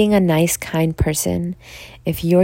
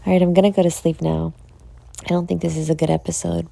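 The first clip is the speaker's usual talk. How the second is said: normal talking voice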